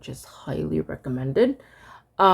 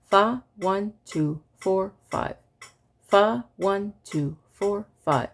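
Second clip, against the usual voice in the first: evenly spaced counting, a bit quicker; faster metronome recitation. Shown as a demonstration. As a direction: chant in time with a faster click. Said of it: "This is at one twenty".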